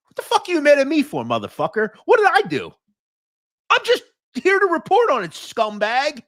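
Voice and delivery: high, loud indignant outburst; angry yelling. Real, not acted. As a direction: shout him down, fed up and outraged. Yelling at commenter Angelo.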